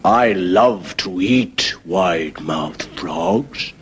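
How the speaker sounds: low, very slow, menacing croc voice; hungry crocodile. Acted. Crocodile drawling to the frog, low and slow. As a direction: savour each word like a predator. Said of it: "And the crocodile says".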